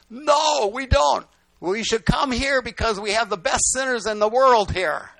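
loud, high hollering across a distance; shouted exchange. Retold or imitated. Pastor and balloon riders trading words, shouting back and forth. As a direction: call it out between ground and sky.

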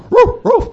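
two high-pitched woofs; imitated dog barking. Dog outside the tent warning his owner, imitated barking. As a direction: bark like an excited dog. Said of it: "He's barking now".

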